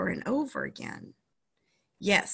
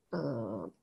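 dog growl; 8 semitones lower, 10 dB quieter than usual. Acted. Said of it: "Searchlight growled".

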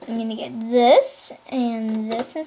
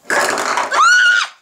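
loud, extremely high shriek; pretend scream; 5 dB louder than usual. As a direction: shriek like a toy tumbling down. Toy falls again.

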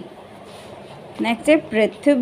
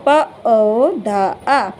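प, ओ, धा, आ drawn out slowly; slow sounding out; much slower than usual. Shown as a demonstration. Teacher to child, sounding out पौधा slowly. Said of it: "तो".

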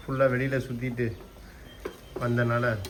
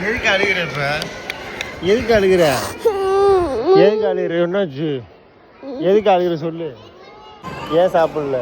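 higher, louder insistent questioning; 5 semitones higher, 7 dB louder than usual. Real, not acted. Parent asking crying son why he cries, insistent coaxing.

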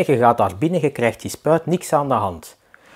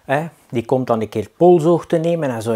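ordinary delivery

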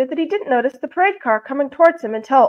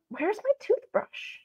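puzzled searching; 8 semitones higher, 7 dB quieter, slower than usual. A narrator's reading. Character searches his bathroom, puzzled.

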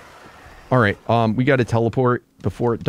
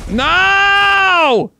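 despairing shout; 6 dB louder than usual. Real, not acted. Creature escapes in game.

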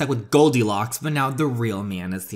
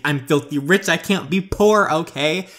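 higher, louder indignant protest; spoiled panic; 5 semitones higher, 5 dB louder than usual. Acted. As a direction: insist you can't be poor. Bella panics at card decline.

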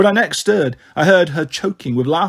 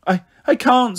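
put-on frightened child; 7 semitones higher than usual. A narrator's reading. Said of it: "started crying".

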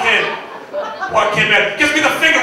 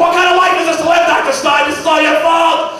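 building angry rant; 4 semitones higher than usual. Retold or imitated. Ruined patient yelling at his doctor, building to blame. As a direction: pile up the grievances until it explodes.

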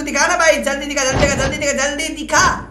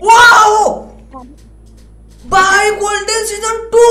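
high, loud, drawn-out wow; excited shout; 7 semitones higher, 6 dB louder, much slower than usual. Real, not acted. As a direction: shout it slowly in amazed delight. Excited at a game item.

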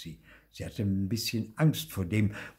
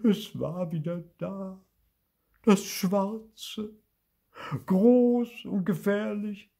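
high, frightened ghost voice; scared whimper with dread; tearful wail; 12 semitones higher, 5 dB louder, slower than usual. A narrator's reading. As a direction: wail it in halting bursts. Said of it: "Heulte Bibi auf".

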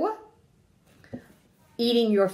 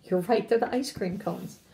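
ordinary delivery, nothing marked

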